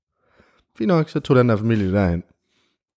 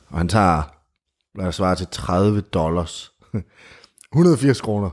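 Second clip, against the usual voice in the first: normal talking voice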